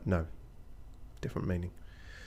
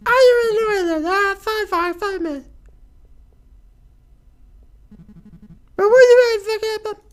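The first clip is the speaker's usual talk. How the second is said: very high, loud squeaky guard voice cracking into laughter; squeaky guard breaking up; 11 dB louder than usual. Acted.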